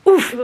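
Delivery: short grunt on impact; exclaimed oof. A narrator's reading. Two boys collide at the snack table, startled oof. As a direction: grunt as if suddenly bumped. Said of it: "the two of them exclaimed".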